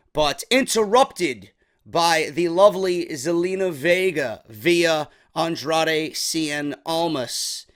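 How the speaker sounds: ordinary delivery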